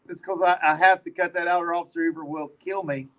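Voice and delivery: low monotone robot voice. Retold or imitated. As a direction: stiff mechanical robot delivery. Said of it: "In your robot voice".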